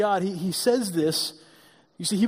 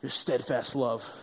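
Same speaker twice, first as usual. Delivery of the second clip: mock disgusted Jonah; 8 semitones lower, 6 dB quieter, slower than usual. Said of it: "he goes".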